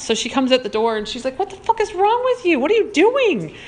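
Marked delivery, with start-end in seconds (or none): 1.4-3.5 much higher, alarmed friend's squeal; shocked friend. Retold or imitated. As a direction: voice the startled friend yelping at him. Friend voiced, shocked outburst.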